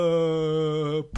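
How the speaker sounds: drawn-out mock scream on one vowel; long falling scream. Both bears falling through the air, long mock scream. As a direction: sustain a storybook falling cry.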